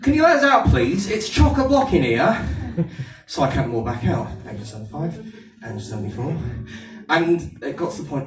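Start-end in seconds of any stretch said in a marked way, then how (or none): 0.0-2.4 Aldershot sheep. Pretend. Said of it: "who has an Aldershot accent just like me".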